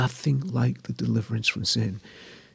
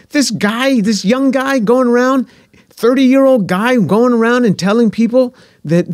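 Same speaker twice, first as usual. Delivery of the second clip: much higher and louder, an indignant mother ranting; mother's voice; 11 semitones higher, 8 dB louder than usual. Retold or imitated. Mother giving her verdict on the New Testament, high indignant rant. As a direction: imitate his mother fuming at Jesus. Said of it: "she said".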